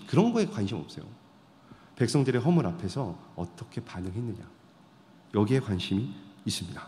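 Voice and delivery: ordinary talk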